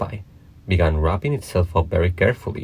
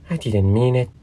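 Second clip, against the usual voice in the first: higher-pitched little plea; pleading; 5 semitones higher than usual. A narrator's reading. Alice pleads with the offended mouse, mildly pleading. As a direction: plead gently in a girlish raised voice. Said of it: "pleaded poor Alice".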